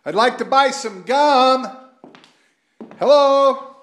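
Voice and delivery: loud, high call into the empty store; calling down a hallway. Real, not acted. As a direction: shout out to find someone in back.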